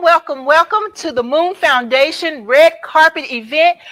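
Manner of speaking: loud, bright red carpet host voice; gushing red carpet chatter. Acted.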